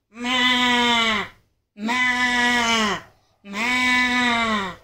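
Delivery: bleating held out; a steady baa; goat bleat. Acted. Kid goat demonstrates its bleat.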